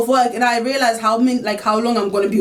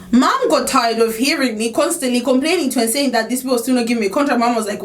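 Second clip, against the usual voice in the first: usual speaking voice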